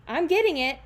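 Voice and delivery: a high, loud shout; shouting cameraman. Acted. The cameraman shouts back to the panicking reporter. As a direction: yell it over the chaos. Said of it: "the cameraman shouted".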